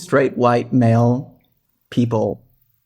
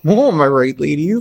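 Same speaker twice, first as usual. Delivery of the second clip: mock comic; 3 semitones higher, 4 dB louder than usual. Joking aside to cohost.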